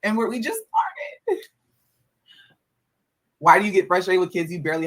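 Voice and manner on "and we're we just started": mock whine. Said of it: "Kids have me stressed the hell out".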